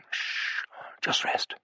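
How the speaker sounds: put-on hushed whisper to a sleepy child; soothing whisper. A narrator's reading. Father whispering to his sleepy son, hushed and soothing. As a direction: breathe it softly, just air.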